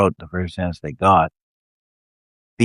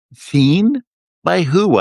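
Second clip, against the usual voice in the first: much higher and louder, scoffing disbelief; incredulous laugh; 10 semitones higher, 6 dB louder, much slower than usual. Acted. Wanderer scoffs at the woman's claim, incredulous and loud. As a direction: toss the question back in amused disbelief. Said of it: "I laughed".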